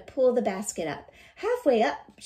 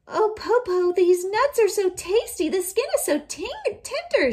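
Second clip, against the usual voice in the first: put-on teasing child; 11 semitones higher, 6 dB louder than usual. Teasing wolf with tasty nuts.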